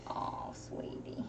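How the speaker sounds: gentle whispered comfort; soft whisper. Real, not acted. Mom soothing hurt son.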